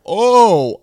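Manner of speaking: loud, high drawn-out oh; booming mock exclamation; group reaction. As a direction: imitate a group's big reaction. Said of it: "And they go".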